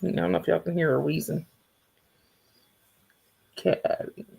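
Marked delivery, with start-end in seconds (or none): 3.6-4.3 soft breathy call to the cat; hushed call to a pet; whispered call. Real, not acted. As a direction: whisper her name so she doesn't startle. Calling cat softly.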